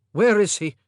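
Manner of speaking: loud, hard demand. A narrator's reading. King demanding to see his dead son, loud. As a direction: bark out the question. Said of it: "Henry demanded".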